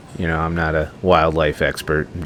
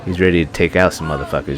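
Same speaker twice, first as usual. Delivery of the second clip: normal talking voice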